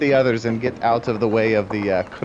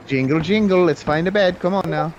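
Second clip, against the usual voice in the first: higher, singsong luring; coaxing; 5 semitones higher than usual. Acted. Dwarf lures tree off to find a bed, coaxing. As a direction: lead them along like a small child.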